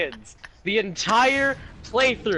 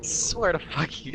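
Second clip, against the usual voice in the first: normal talking voice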